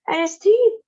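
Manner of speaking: put-on high, loud boyish cry; excited cry. A narrator's reading. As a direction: cry out in delight. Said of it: "cried Charlie".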